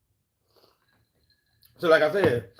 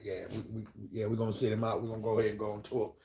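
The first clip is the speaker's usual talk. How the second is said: soft, lower dismissive plan; offhand quiet; 5 semitones lower, 9 dB quieter, faster than usual. Retold or imitated. Otis decides to bench a sick member, soft offhand. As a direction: shrug him off quietly.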